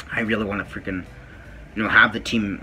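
ordinary talk, nothing marked

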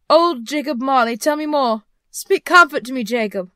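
high, loud begging; imploring. A narrator's reading. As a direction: plead urgently for comfort. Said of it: "he said imploringly".

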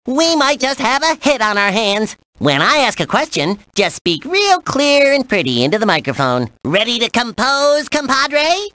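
perky, showy cartoon character; cartoon game host. Host briefs the player on writing a song, cartoon game host. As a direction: present it like a playful cartoon host.

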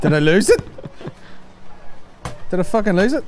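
loud, high-pitched disbelief; real alarm. Real, not acted. Podcaster losing the TikTok link mid-show, alarmed. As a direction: blurt it out in rising panic. Link vanishes while they try playing it.

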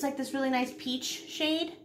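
usual speaking voice

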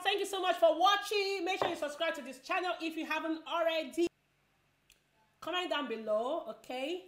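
high, loud sign-off; excited outro. Real, not acted. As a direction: call out the goodbye brightly.

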